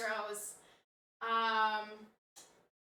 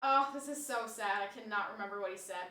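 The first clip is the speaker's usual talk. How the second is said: ordinary talk, nothing marked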